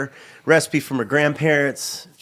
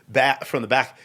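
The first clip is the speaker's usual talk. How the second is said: ordinary talk, nothing marked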